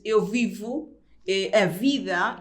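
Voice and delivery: "vivo" and "vida" with a clear labiodental V; emphatic Portuguese V in "vivo", "vida"; marked Portuguese V. Shown as a demonstration. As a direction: mark the V in "vivo" and "vida", teeth on lip. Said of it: "tienes que marcar esa V".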